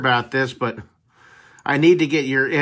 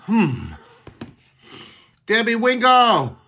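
Mister Duck's reaction; 8 semitones higher, 3 dB louder, slower than usual. Retold or imitated. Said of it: "he goes".

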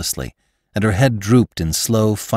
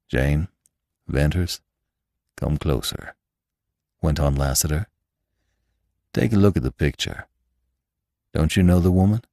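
gentle Lassiter; 4 semitones lower than usual. Acted. Lassiter shows Jane and Venters the picture, low and gentle.

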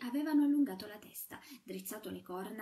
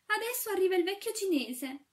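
loud, much higher scream of alarm; frightened cry; 10 semitones higher, 9 dB louder than usual. A narrator's reading. Shepherdess seeing the old Chinese awake, loud high cry. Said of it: "gridò la pastorella".

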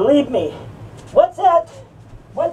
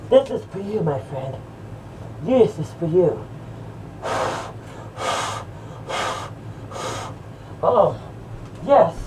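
low, savoring murmur of pleasure; blissed-out stoner; 9 semitones lower, 7 dB quieter than usual. Pretend. Smoker sharing a hit with a friend, blissed-out and low. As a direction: say it low between long drags.

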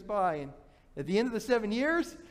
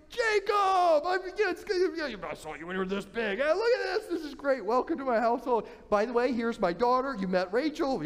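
effusive uncle; 6 semitones higher than usual. Acted. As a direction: greet him like a long-lost nephew. Laban welcomes nephew Jacob.

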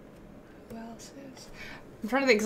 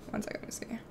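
whisper; 15 dB quieter, faster than usual. Real, not acted. Aside off mic.

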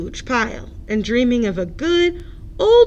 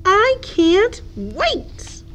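eager pig; 8 semitones higher, much slower than usual. Acted.

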